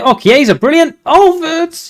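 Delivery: high, rising thrill at the chance; urgent, high cheer for the move; excited commentary. Real, not acted. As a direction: voice leaping up as the attack opens. Big chance building in commentary.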